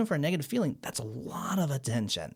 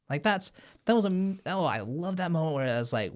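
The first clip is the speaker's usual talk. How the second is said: normal talking voice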